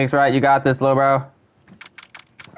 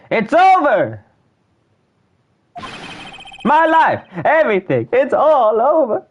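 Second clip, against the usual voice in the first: despairing wail; 11 semitones higher, slower than usual. Acted. Client screaming over there, hammed-up despairing wail. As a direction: wail as if the world ended.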